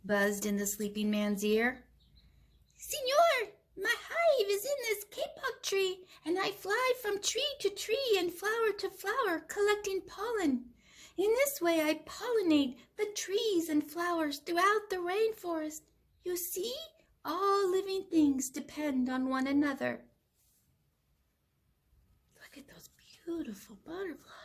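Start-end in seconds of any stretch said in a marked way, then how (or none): 2.8-20.1 very high, small bee voice; a tiny bee pleading with the sleeping man; buzzing bee. Acted. Bee voice in story. 22.4-24.3 soft, fast whispered aside. Real, not acted. Aside to children over picture.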